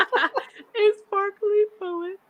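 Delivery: slow, high, laughing words; giggling slowly at a compliment. Real, not acted. Reacting to a kind comment.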